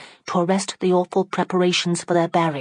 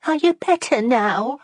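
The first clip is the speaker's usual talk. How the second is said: quavering voice; 9 semitones higher, 3 dB louder than usual. A narrator's reading. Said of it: "in a quavering voice".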